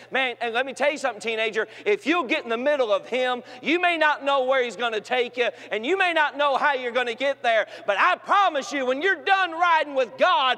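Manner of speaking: put-on preacher promising the teenagers; preaching climax building. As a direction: build to a final shout.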